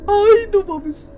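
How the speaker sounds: high, slow frightened wail; real fright; scared cry. Real, not acted. Sees the ghost again.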